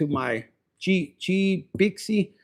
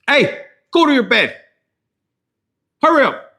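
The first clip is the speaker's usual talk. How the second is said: loud, higher scolding call; calling out sharply; 7 semitones higher, 7 dB louder than usual. Retold or imitated. Owner ordering dog to bed, loud higher scolding call.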